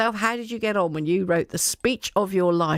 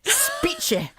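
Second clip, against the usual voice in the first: "Speechy" squeaked much higher in a hammed-up Scottish accent; mock Scottish accent. Host teasing the guest's accent, hammed-up Scottish. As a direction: overdo the Scottish "Speechy", way up high. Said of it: "It's a lovely Scottish accent".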